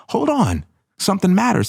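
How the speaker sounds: high, urgent inner voice calling hold on; alarm in the heart. Retold or imitated. Daughter's heart sounding the alarm, high and urgent. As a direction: raise the pitch like a ringing alarm.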